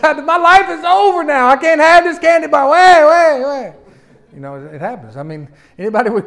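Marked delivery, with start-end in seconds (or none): 0.0-3.7 high, loud hammed-up bawling; child pitching a fit. Child refused a candy bar, mock tantrum. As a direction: wail as if the world has ended. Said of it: "he pitches a fit".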